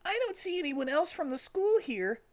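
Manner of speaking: very high, confused question; baffled little sidekick; puzzled Tails. Acted. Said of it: "said Tails".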